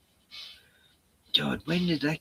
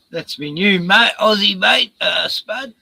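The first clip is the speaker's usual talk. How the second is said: high, loud excited cheer; 9 semitones higher, 8 dB louder than usual. Real, not acted. Host loudly shows off his new Aussie mate, excited.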